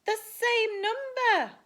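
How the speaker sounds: high, loud, drawn-out shout. A narrator's reading. Spinderella shouts at the matching count, high and loud. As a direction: shout it slowly in a high, excited voice. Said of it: "shouted Spinderella".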